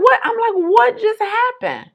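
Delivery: high, slow stunned question; shocked disbelief. Retold or imitated. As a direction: act out total bewilderment.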